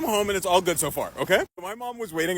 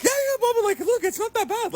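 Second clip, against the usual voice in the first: innocent pleading; 14 semitones higher than usual. Retold or imitated. Son pleading innocently with dad.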